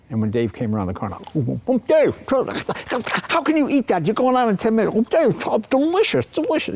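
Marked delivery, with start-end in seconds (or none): 1.7-5.0 high, squeaky fretting voice, mocking a nervous host; nervous Dave. Dave nervous before the show. 5.0-6.7 high, sing-song taunt over a sandwich; teasing. Retold or imitated. Leno taunting nervous Dave with a meatball sandwich, high sing-song.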